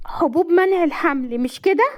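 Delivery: high-pitched alarmed guess; shocked accusation. A narrator's reading. Wife accusing husband of drugging juice, shocked. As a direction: blurt the dreadful suspicion.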